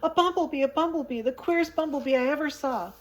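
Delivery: high, loud alarmed cry; panicked high-pitched yell. A narrator's reading. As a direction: shriek it out, batting at a bee. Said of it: "crying out".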